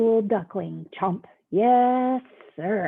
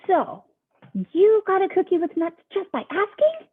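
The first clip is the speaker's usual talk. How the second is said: high, loud pigeon voice asking; eager pigeon; 9 semitones higher, 7 dB louder than usual. Acted. Pigeon indignant question.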